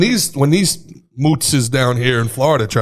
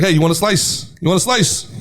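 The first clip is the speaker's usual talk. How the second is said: hurried New Yorker; 6 semitones higher than usual. Retold or imitated. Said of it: "if you're in a hurry".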